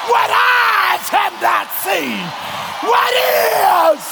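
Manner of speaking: high slow shouted climax; shouting. Acted. Climax of preaching.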